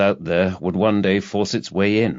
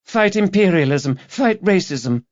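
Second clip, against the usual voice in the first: put-on high, earnest young woman repeating her slogan; young activist girl; 11 semitones higher than usual. A narrator's reading. Student repeating her slogan to Rebus, high earnest voice. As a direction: pitch up into the keen campaigner again.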